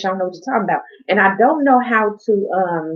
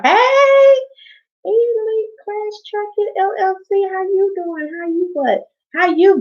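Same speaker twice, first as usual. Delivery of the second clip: excited greeting; 12 semitones higher, slower than usual. Real, not acted. Greeting a viewer in chat.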